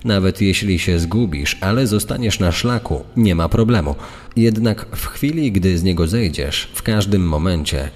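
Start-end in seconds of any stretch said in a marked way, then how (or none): none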